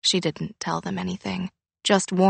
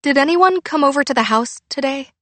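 high, louder, anxious question; suppressed terror; 7 semitones higher, 5 dB louder, faster than usual. A narrator's reading. Frightened daughter questioning her mom, fear held back. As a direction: keep it normal over a touch of terror. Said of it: "terror in her voice".

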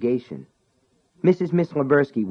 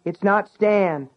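called back; 10 semitones higher, slower than usual. Acted. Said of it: "Sean called back".

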